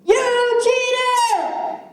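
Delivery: high, loud accusing yell; angry yell. Acted. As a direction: shout it like you're furious. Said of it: "He yelled".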